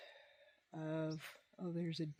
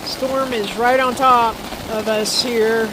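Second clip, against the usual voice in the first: raised voice over the storm; in a thunderstorm; 5 semitones higher, 19 dB louder than usual. Real, not acted. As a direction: talk loud over pounding hail. Storm hammering the tent.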